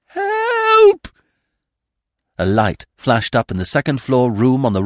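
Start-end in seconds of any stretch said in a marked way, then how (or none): 0.1-1.1 high, loud, long-held scream of "Help"; a thin, drawn-out cry from far inside the house; desperate stretched-out call for help. Acted. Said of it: "a long, thin, drawn cry".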